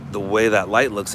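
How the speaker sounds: ordinary talk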